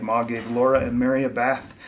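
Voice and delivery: plain reading voice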